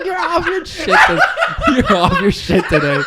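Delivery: high, loud, laughing outburst; cracked up and exclaiming; laughing jab. Real, not acted. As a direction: rib your friend through real laughter. Cracking up at friend.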